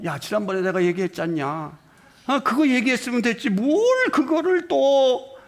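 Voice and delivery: the whining scold of a fed-up parent; irritated parent. Retold or imitated. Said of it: "그때 짜증을 내잖아요". (짜증 난 부모 흉내)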